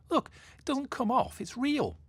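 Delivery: put-on high, insistent young mermaid; lightly voiced girl proving she's real.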